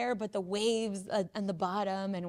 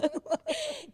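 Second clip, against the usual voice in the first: sudden high laugh. Real, not acted. Laughing at the old-fashioned word, high giggle.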